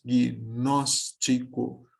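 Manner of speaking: Gnóstico drawn out slowly, gnós-ti-co; slow teacher demo of Gnóstico; syllable by syllable. Shown as a demonstration. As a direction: spell out Gnóstico in slow beats. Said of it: "eu devo seguir o impulso de voz".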